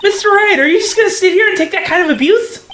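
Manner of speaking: high, quick indignant sidekick; assistant voice. Acted. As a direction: urge him on like a sidekick. Voicing assistant character.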